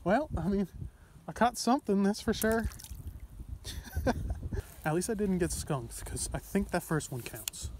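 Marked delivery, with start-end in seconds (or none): 2.7-4.8 breathy disbelieving laugh. Real, not acted. Laughs at tiny catch.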